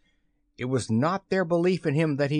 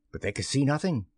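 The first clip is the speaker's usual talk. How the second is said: ordinary delivery, nothing marked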